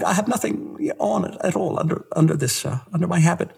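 high, small, hesitant confession voice; tremulous nun. Acted. Petite nun admitting her sin to the father, tremulous. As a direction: stammer shyly through it as a timid little nun. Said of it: "little petite, uh, tremulous nun".

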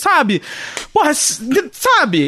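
high, exasperated venting; mock frustrated rant to a friend; lightly played exasperation. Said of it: "eu fico falando assim".

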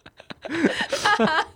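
high laugh. Real, not acted. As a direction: laugh along brightly. Laughs at punchline. (笑出声)

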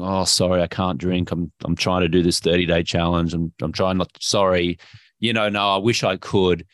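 low, deflated mock apology; acting out a glum, sorry non-drinker; apologetic killjoy. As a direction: mope through a sheepish excuse. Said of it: "you're being a killjoy".

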